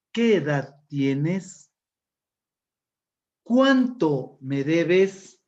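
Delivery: very slow, each word stretched out; question intonation. Shown as a demonstration. Teacher models questions for class, emphatic question intonation. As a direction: stretch "Cuánto" loud and long as a question. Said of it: "la entonación que le da los signos de interrogación".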